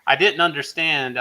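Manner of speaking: usual speaking voice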